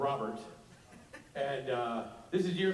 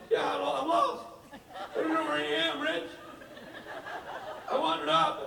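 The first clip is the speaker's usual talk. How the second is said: high, lost and helpless mock whine; panicked Rob; 11 semitones higher than usual. Lost friend calling Rich, high helpless whine. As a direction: pitch it up like a lost drunk friend.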